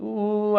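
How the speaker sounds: soft, drawn-out fake sob "ooh"; half-hearted crying noise without tears; imitated tearless crying. Shown as a demonstration. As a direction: hum a dry mock wail. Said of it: "అంటే ఏడుపు అంటే ఏంటనుకున్నారు".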